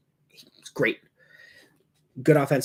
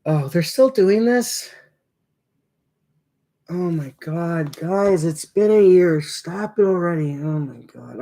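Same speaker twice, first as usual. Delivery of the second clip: high, loud disbelieving groan; fed up, stretching out the oh; exasperated, can't believe they're still doing it; 4 semitones higher, 4 dB louder, slower than usual. Real, not acted. Exasperated at the repeated tribute.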